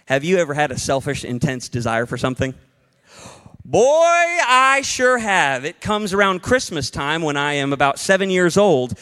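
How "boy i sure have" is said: slow, high, louder admission; drawn-out confession. Real, not acted. Preacher admits his own greed to the church, drawn out and high. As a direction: stretch it out like a big confession. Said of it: "confessing own desire".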